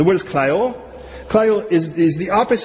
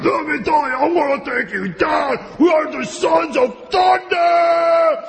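luchador wrestler voice; 10 semitones higher, slower than usual. Retold or imitated. Preacher playing luchadors bragging in the wrestling ring, shouting. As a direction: announce yourself like a masked wrestler stepping into the ring. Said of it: "like, luchadors".

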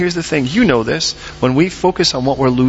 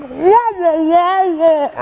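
high, wordless wailing squawk; Charlie Brown wah-wah; 4 dB louder, much slower than usual. Retold or imitated. Neighbors' quarrel heard without words, cartoonish high wah-wah. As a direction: imitate muffled cartoon talk with loud wahs. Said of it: "sort of like Charlie Brown".

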